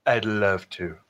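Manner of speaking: lightly put-on voice; a mild mock character voice; mock impression. Agreeing to a podcast host in a mild put-on voice. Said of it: "That's a very good impression".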